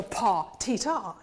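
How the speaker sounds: "pa" accented, then light, lifted "ti, ta"; desired energy. Shown as a demonstration. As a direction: show the right lift after an accented "pa". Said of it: "I want it to go".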